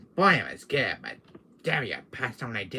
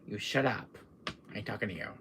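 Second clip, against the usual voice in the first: usual speaking voice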